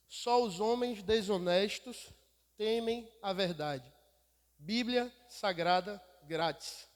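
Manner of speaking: slow, measured proclamation of a written slogan; deliberate slogan reading. Retold or imitated. Preacher quotes the words painted on a truck to the church, slowly and deliberately. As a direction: read it out slowly, phrase by phrase, with weight.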